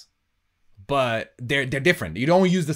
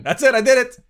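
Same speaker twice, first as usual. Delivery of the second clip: higher, louder mock declaration; mock retirement speech; 9 semitones higher, 6 dB louder than usual. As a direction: play-act a triumphant farewell for him. Jokingly voices guest announcing retirement.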